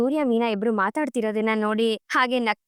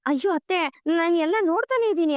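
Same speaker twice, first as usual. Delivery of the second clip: higher-pitched, mildly flustered protest; lightly acted self-defence, pitched up; defensive protest; 6 semitones higher than usual. Scolded daughter-in-law answers mother-in-law, mildly defensive.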